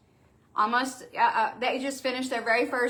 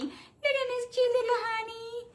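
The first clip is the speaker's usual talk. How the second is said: cooing at the dog; 13 semitones higher, 7 dB quieter than usual. Real, not acted. Owner cooing at the poodle Miss Honey, high baby talk.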